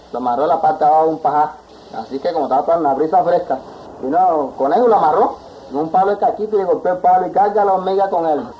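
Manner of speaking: plain reading voice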